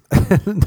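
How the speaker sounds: sudden high burst of laughter; real laugh. Real, not acted. Laughing at American taters, a real high laugh. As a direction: crack up at the word.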